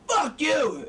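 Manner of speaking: high-pitched, hammed-up angry shout. Shown as a demonstration. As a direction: overact fury for a demonstration. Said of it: "if you're angry".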